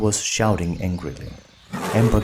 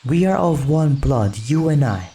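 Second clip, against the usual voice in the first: proud recital; 5 semitones higher, 4 dB louder than usual. Acted. Boy proudly reciting master words.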